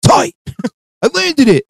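high-pitched hammed-up celebration; mock triumph. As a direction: squeal it like a trick just landed. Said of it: "you hear one of us go".

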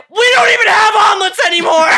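loud, high laughing snap; shouted mock fury; exasperated retort. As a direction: fire it back, fed up. Snapping at rude customers.

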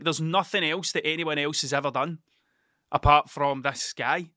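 ordinary talk, nothing marked

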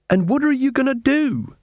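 high-pitched challenge; demanding beggar queen. Acted. Beggar queen challenges the watch, high demand.

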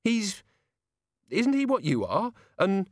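high-pitched woman demanding answers; incredulous, voice pitched right up; Susan, disbelieving. A narrator's reading. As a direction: question it in a high, shocked voice. Susan questions Death, shocked.

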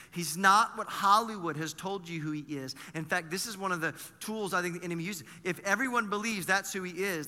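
ordinary talk, nothing marked

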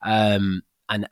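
normal talking voice

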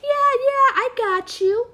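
high, breezy, dismissive yeah; imitating breezy voice. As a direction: toss it off like a careless coworker. Imitating A's breezy reply.

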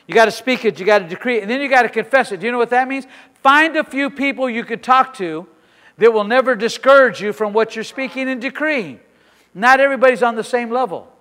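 loud, rising preacher's cry; fiery preaching. Real, not acted. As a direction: build the decree like a revival preacher. Preaching at full force.

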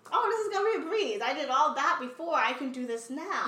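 high, quick start, easing down as it goes; breezy overconfidence. Retold or imitated. Student recalling the start of a master's, breezily confident. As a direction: replay your earlier cocky self-assurance.

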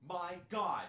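high, melodramatic mock hysteria; hammed-up frantic indignation; freaking out. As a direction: imitate an outraged mother in a panic, pitch way up. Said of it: "Immediately starts freaking out".

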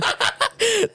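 laughing high and hard; high laughter. Real, not acted. Laughing at the slip.

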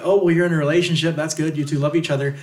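high, fast, gushing approval; cheerful clueless elder; mock older relative. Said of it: "being like".

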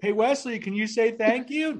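high sing-song parent voice; imitating a parent prompting a toddler. Retold parent prompting a shy toddler.

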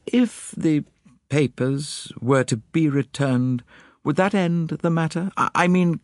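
high-pitched, halting lady's voice; hesitant lady. Acted. Lady making delicate offer to detective, high and hesitant. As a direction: feel your way through a difficult request. Lady Juliet's dialogue in narrated story.

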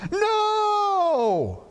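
put-on high crying child yell; crying toddler shout. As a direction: wail no like a toddler.

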